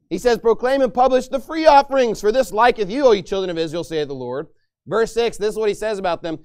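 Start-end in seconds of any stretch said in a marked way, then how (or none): 0.4-4.5 mocking. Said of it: "he's mocking them for their transgressions".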